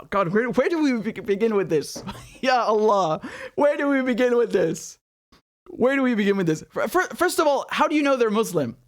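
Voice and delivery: ordinary delivery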